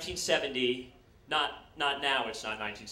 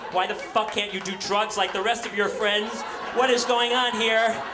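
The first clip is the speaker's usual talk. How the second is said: loud, higher, exasperated yell; imitating mom losing it at him; exasperated mother; 5 semitones higher, 8 dB louder than usual. Mother yelling at her Orthodox son, mock exasperated shout. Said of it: "My mother's like".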